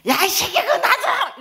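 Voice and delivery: high, loud, dragged-out mock yell; hammed-up cranky shout; angry drunken yell. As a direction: bellow like an irritated drunk. Drunk man snapping at the volunteer.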